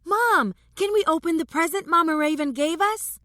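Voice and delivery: put-on high-pitched eager child interrupting; boy's shout. A narrator's reading. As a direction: call out to mom in a small boy's high voice. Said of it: "the boy shouted".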